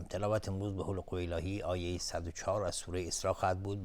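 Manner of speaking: ordinary delivery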